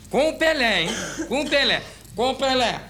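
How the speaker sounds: "com o Pelé" high and punched each time; insistent emphasis. Retold or imitated. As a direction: repeat "com o Pelé" triumphantly. Boss insisting on Pelé, repeated.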